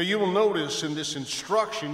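normal talking voice

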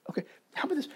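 hushed whispered aside. Real, not acted. Pastor whispering a challenge to the congregation, hushed aside. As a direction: lean in and whisper it.

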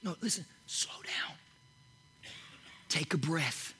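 slow down whispered, nearly breath; soft whisper. Real, not acted. Preacher calms the congregation, in a whisper. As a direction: hush the room gently. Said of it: "I'm asking you to slow down".

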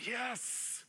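a hint of a little kid's yelp; excited little kid. Paul as a kid at the aquarium, lightly voiced. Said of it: "Paul is like a little kid standing".

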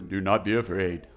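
a low, solemn heavenly voice; deep angel voice. Acted. Preacher voicing the angel, deep and solemn. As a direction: drop low for the angel's line.

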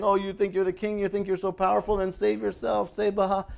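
high, sing-out mock taunt. Preacher imitating people mocking Jesus on the cross, mocking. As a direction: imitate a crowd jeering at him. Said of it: "mocking him".

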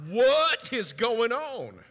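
loud, slow, jolted what-is-going-on; startled alarm. Retold or imitated. Waking to something on his feet, startled and loud. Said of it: "I would be a little bit, uh, like".

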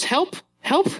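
put-on high little-girl plea to help; toddler voice. Dad imitating his daughter at the dishwasher, high and keen. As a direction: squeak it out like an eager two-year-old. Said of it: "she goes".

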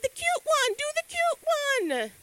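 put-on high, eager kid voice; a kid picking the cute package; child's voice. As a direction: answer brightly like a little kid. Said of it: "And the kid's like".

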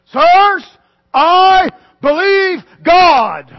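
slow, loud, high declaration of faith; ringing proclamation. Shown as a demonstration. Preacher to congregation, proclaiming faith slowly and loudly. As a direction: proclaim it slowly to the whole church. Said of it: "It just sounds kinda cliche".

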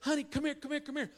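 high-pitched calling, no real volume; mock holler. Lady hollers for her daughter who walked away, lightly mimicked high call. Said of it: "she hollered for her daughter".

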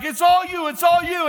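high, loud cheering shout; retold yelling. Spotter cheering on a failing lifter, imitated yelling. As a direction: yell like an over-eager spotter. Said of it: "then would start yelling".

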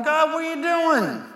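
alarmed cry. Retold or imitated. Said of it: "I'm going".

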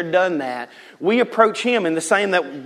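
normal talking voice

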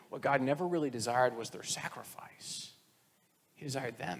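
soft, whispered confidence; a quiet intimate aside. Real, not acted. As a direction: lean in and whisper it. Hushed sermon point.

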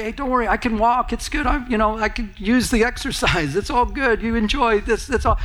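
high, bright-toned giving up the spot; cheerful selfless giver. Pretend. Church member telling a stranger to take the parking spot, cheerfully high. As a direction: sound happy to walk, voice lifted well up. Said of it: "and you say".

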